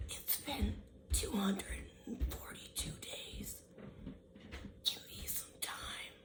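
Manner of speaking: breathy spooky whisper over music; eerie whisper. Acted. Ominous whisper to the listener. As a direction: whisper it like a haunting.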